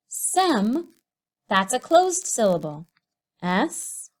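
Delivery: usual speaking voice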